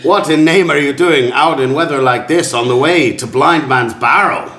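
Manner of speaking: chatty carriageman. Acted. Carriageman questions travellers out in bad weather, theatrical and chatty. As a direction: quiz the passengers as a theatrical coach driver. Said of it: "not quite sure what this accent is".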